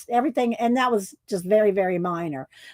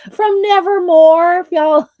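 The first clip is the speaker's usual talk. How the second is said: laughing out loud; 7 semitones higher, 9 dB louder than usual. Real, not acted. Bursting laugh after poem.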